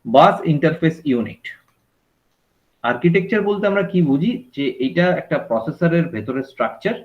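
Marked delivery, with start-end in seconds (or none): none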